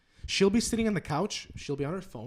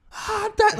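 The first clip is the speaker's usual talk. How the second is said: a full-force scream, much higher and louder; dad's scream; 9 dB louder than usual. Retold or imitated. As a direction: scream it with everything you have. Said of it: "like, full force".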